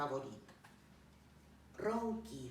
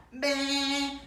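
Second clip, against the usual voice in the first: loud, high, sustained baa; sheep bleating; 8 semitones higher, 9 dB louder than usual. Acted. (balido fuerte de oveja)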